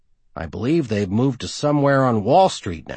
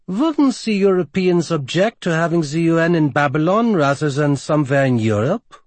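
put-on Romanian accent, pitched up and louder; a Romanian woman questioning, lightly voiced; thick Romanian accent; 6 semitones higher, 4 dB louder than usual. A narrator's reading. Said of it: "In her thick Romanian accent".

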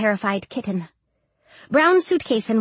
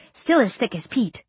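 usual speaking voice

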